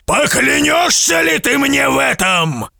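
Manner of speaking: high, stern demand; giant demanding oath. A narrator's reading. Giant demanding the merchant swear to return, stern high demand. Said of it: "спросил исполин".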